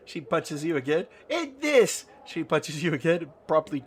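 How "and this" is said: very high, squeaky battle yelp; feisty attack cry. Acted. Nanny punches the player playfully, high and feisty. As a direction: cry out with each harmless punch.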